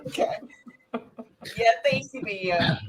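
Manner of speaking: ordinary talk, nothing marked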